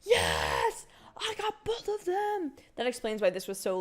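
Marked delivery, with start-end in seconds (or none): 0.0-2.5 a cry of triumph, then much higher and slower; excited cheer. Real, not acted. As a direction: shout of victory. Gets both characters, cheers.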